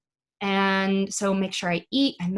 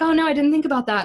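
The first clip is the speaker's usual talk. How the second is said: quick, higher-pitched mock excuse; unprepared actor; 7 semitones higher, 4 dB louder, faster than usual. Imitating an actor who hasn't prepared the scene, caught out.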